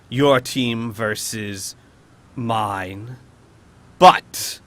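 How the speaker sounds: slow, deliberate villain with explosive single words; commanding monster, long menacing pauses; imperious beholder. Acted. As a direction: pronounce the challenge slowly, punching key words. Game master voices the challenged beholder.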